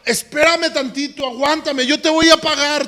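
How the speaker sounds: high, loud preacher storytelling; fervent preaching. Acted. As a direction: preach the parable with rising fire. Preaching the parable at full force.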